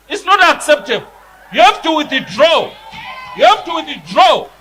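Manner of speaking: angry shouting. Real, not acted. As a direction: demand it hotly, hammering withdraw. Member demands the Speaker withdraw.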